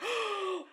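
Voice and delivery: high, sharp acted gasp; mock shocked gasp. Imitating girls shocked at the movie, mock gasp. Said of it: "they're just shocked".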